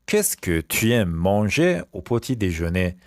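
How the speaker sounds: slow, spaced-out French question; slow repeat. Shown as a demonstration. Teacher repeats the breakfast question for pupils, slowly. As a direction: stretch it out for learners.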